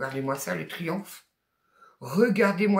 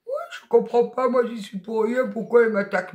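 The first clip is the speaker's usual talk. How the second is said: whiny victim; 4 semitones higher, 4 dB louder than usual. Retold or imitated. Mimicking a hurt backstabber.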